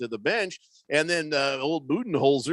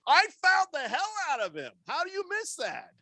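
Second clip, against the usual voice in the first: high, disbelieving player's voice; incredulous player; 11 semitones higher than usual. Retold or imitated. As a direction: play Devin Booker unable to believe the call.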